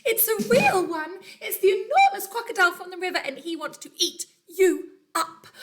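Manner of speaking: a frantic little bird crying a warning; urgent bird warning. Acted. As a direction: bird alarm, rushed and high. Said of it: "sang the roly-poly bird".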